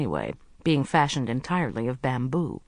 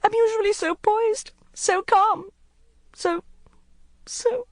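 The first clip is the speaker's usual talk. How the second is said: very high, breaking off; stammering distress; Court, flustered; 4 dB louder than usual. A narrator's reading. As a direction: lose the words. Narrator voices Court.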